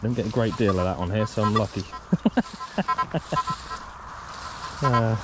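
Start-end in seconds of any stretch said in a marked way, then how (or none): 1.9-4.8 short laugh. Real, not acted. Laughing at a wire find.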